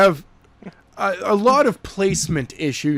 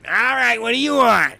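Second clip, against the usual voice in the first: mock gruff soldier; 8 semitones higher than usual. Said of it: "these soldiers are like".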